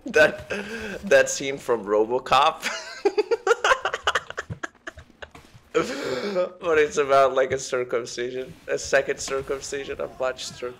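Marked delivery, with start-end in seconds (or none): 2.7-5.7 breathless high-pitched cackle; can't stop laughing, wheezing. Real, not acted. Cracking up at own joke.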